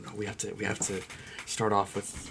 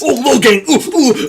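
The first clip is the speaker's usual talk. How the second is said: excited squeal; 20 dB louder than usual. Real, not acted. Mock gushing.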